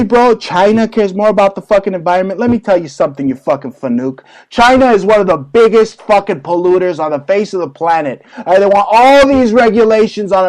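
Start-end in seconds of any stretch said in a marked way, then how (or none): none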